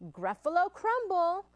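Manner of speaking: name the dish with relish; menacing mouse. Acted. Mouse tells Gruffalo his favorite food, menacing.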